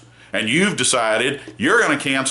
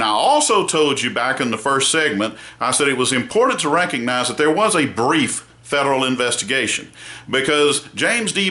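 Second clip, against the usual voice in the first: ordinary delivery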